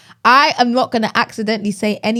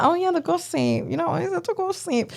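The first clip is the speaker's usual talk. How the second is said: light Cockney accent, higher pitch, trailing off softer; Cockney gossip voice; 8 semitones higher, 6 dB quieter than usual. Shown as a demonstration. Host showing how to gossip in a light Cockney accent. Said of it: "You've got to have a Cockney accent".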